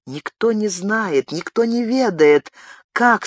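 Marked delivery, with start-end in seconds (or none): none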